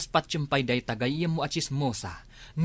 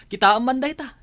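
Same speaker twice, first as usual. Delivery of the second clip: mocking laugh; 10 semitones higher, 9 dB louder than usual.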